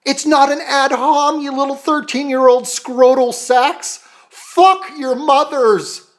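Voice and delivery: high-pitched indignant outburst; exasperated tirade building to a drawn-out yell; angry rant. Real, not acted. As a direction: raise the pitch and stretch the insults out. Insulting hecklers who cry ad hom.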